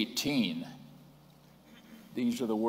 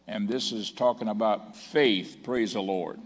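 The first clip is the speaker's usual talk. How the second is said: usual speaking voice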